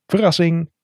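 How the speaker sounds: bright, high cry of surprise; spring the surprise on him, high and pleased; excited call. Acted. Alex springs his surprise on his friend, high excited call. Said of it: "roept hij".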